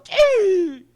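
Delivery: loud, high "ey!" of revulsion; disgusted cry. Acted. Preacher acting out being in the fish's slimy belly, disgusted cry. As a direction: cry out as if hit by slime. Acts disgust inside the fish.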